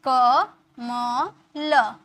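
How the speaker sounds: very slow, each syllable of क म ल apart. Shown as a demonstration. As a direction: break क म ल into separate sounds. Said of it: "मैंने लिखा ना सिखाया आपको".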